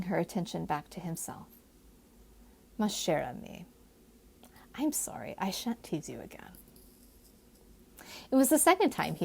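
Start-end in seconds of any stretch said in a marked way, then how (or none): 2.8-6.5 tender apology. Acted.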